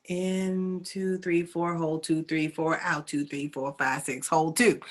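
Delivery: calm, steady counting of the breath; counted breathing. Acted. Teacher counting a breath cycle for students, in a calm voice.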